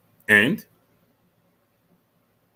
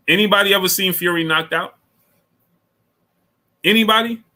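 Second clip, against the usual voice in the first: incredulous callout; 11 semitones higher than usual. Real, not acted. Challenging his chat about Fury, high incredulous callout. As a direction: dare anyone to answer, pitch up high.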